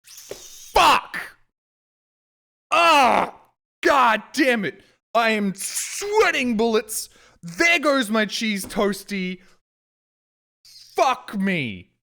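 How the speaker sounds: high, loud shouted curses; screaming curses as the bird wrecks breakfast; furious shout. Retold or imitated. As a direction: yell it at the seagull. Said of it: "And I'm like".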